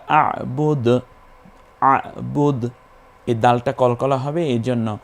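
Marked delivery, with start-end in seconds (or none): 0.1-2.7 আবুদ opened with a squeezed throat, d bounced; throat-pressed ain. Shown as a demonstration. Teacher shows the ain sound, আবুদ pressed from the throat. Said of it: "আইনটা গলায় চাপ দিয়ে".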